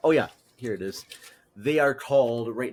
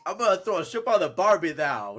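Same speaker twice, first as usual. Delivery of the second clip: bad Australian-Cockney attempt at Minnesotan; badly faked Aussie accent, a little high; horrible fake accent; 6 semitones higher, 4 dB louder, faster than usual. Shown as a demonstration. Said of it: "in a horrible Cockney accent".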